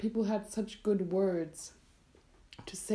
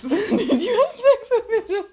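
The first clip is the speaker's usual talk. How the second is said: high, loud laugh through the question; 13 semitones higher, 12 dB louder than usual. Real, not acted. Laughing disbelief at the fish joke.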